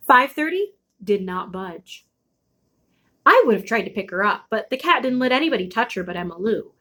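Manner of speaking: ordinary delivery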